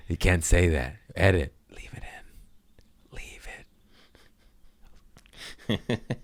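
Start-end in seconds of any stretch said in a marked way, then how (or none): 1.7-6.1 very soft, slow whisper; hushed whisper to the editor; whispered aside. Real, not acted. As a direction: whisper it slowly, as if off the record. Aside after saying "Edit.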